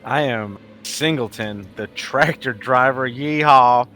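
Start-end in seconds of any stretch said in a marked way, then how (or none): none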